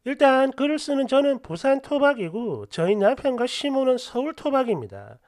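ordinary talk, nothing marked

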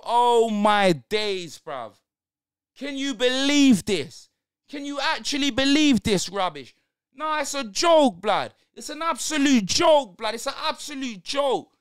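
loud, high, drawn-out fuming at the game; furious rant. Real, not acted. Fan ranting at his losing team, loud and furious. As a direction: rant at the screen like it's personal.

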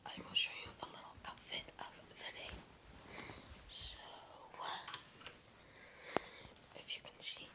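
faint whisper showing the outfit. Real, not acted. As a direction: keep it to a hushed whisper. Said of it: "I have to be super quiet".

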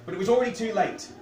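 ordinary delivery

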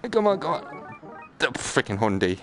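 usual speaking voice

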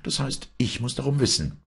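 high, demanding woman's voice; emphatic high-voiced wife; insistent wife. A narrator's reading. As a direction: insist on your right to know, pitched high. Wife insists on being told.

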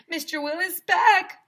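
high, loud excited call; shouted. Acted. A kid spots the ice cream man, loud high shout. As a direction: shout it out across the playground. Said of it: "someone shouted".

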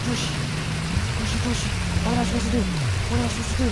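high, loud frantic screaming; panicked yelling. Real, not acted. Player drowning in the game, shrieking in loud panic.

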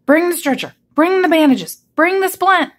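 high-pitched rescuer's orders; urgent shout. A narrator's reading. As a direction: fire off urgent commands in a high voice. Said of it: "he shouted".

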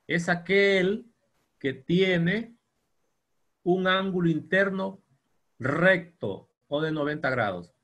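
teacher dictating a definition, slow; slow dictation. Shown as a demonstration. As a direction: dictate it word by word to the class.

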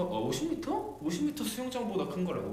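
real surprise at the size; surprised. Real, not acted. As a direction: caught off guard, pitch jumping up. Stunned by fifty-metre size.

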